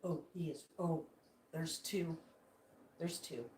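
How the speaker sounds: very soft, low hush. Real, not acted. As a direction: keep it down so the birds aren't startled. Creeping up to watch the birds.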